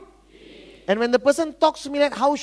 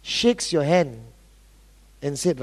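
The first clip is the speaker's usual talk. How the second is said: usual speaking voice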